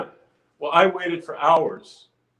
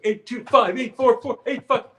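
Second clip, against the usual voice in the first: choreographer counting; 8 semitones higher than usual. Retold or imitated. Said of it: "it's some Bob Fosse".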